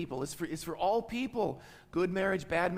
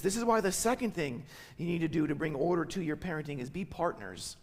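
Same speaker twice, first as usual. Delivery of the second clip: normal talking voice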